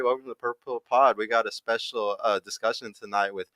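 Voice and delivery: usual speaking voice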